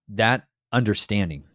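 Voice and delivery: usual speaking voice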